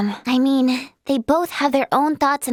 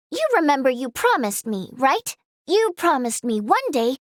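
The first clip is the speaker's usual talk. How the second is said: normal talking voice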